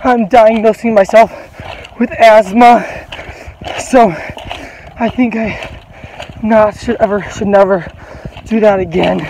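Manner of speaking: loud, half-shouted, still catching breath; loud winded declaring. Real, not acted. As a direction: belt it out as you recover. Shouting into camera after the run.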